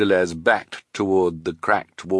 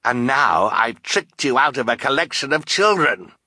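gloating villain; 4 semitones higher than usual. Acted. Count Olaf to the netted Bruce, gloating. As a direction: crow over your victims.

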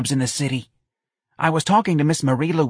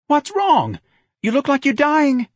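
very high, loud fretful voice; alarmed mother; 5 dB louder, slower than usual. A narrator's reading. Mother opening the door to her ailing son, alarmed. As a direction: fuss shrilly over an ailing son.

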